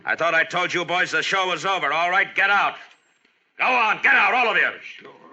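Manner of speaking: building to a furious bellowed order; angry shouting. Acted. Marshal clears the saloon.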